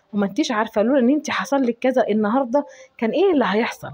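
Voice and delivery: ordinary talk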